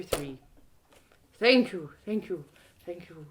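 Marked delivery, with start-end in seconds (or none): none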